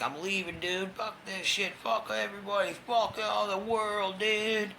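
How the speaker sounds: high, slow, drawn-out mock rant; imitating his own furious exit; angry outburst. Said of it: "I'm like".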